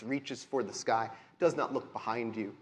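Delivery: ordinary talk, nothing marked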